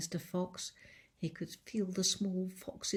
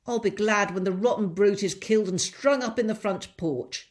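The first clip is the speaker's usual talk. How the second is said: loud, higher calling voice of the big woman; 3 semitones higher, 10 dB louder than usual. Acted. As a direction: call it out up the stairs, loud. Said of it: "she called out".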